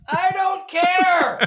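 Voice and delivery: mock Tommy Lee Jones, dragged out and called. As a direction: call it out slow like a distant marshal. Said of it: "isolate that one bit and just play".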